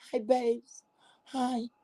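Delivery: soft mock flirt voice; mocking sweet voice. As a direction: coo it like baiting a man. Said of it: "I like how your voice raise".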